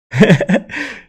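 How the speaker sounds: high bright burst of laughter; loud laugh at a kind chat message; real laugh. Real, not acted. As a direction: laugh out loud, caught off guard. Laughing at viewer's compliment.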